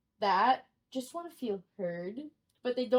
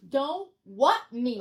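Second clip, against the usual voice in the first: slow, loud mock indignation; indignant reproach; 2 semitones higher, 5 dB louder, slower than usual. Affronted caller to night clerk, loud and slow. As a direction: hit WHAT hard and draw it out. Retold caller, repeated with force.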